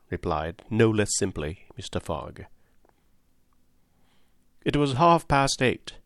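ordinary delivery, nothing marked

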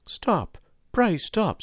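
high-pitched cry to stop; urgent plea. A narrator's reading. Joe begging the carter to stop flogging, urgent plea. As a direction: plead urgently, pitch leaping up.

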